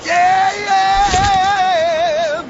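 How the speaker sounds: very high fan shout; excited cheer. Acted. Greeter cheers for the rock star like a fan.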